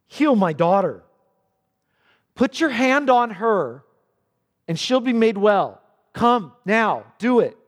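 loud, pressing begging; desperate plea. Acted. Jairus pressing Jesus to heal his daughter, loud and urgent. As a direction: demand he come now. Jairus pleading, retold again.

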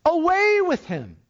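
high, drawn-out mob cry. Acted. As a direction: cry it out high, stretching the words. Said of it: "crying out said".

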